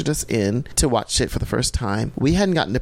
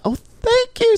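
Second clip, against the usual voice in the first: high falsetto thanks; 4 dB louder than usual. Retold or imitated. Reacting to fan praise.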